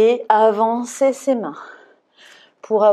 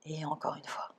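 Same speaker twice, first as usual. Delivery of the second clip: near whisper; 2 semitones lower, 17 dB quieter than usual. Real, not acted. Very soft count during pose.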